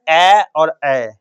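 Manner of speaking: ए और ए drawn out very slowly; slow vowel demo. Shown as a demonstration. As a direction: hold each ए long like a drill. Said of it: "कंठ तालु में क्या आएगा?". (धीरे खींचकर स्वर)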